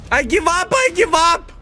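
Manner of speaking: high exasperated cry of giving up; surrendering. Real, not acted. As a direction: throw up your hands and yell it. Frustrated in-game loss.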